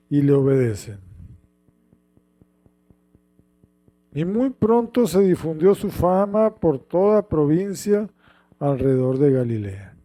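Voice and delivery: measured solemn reading; lectura bíblica. Acted. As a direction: read the passage to the congregation. (lectura solemne del pasaje)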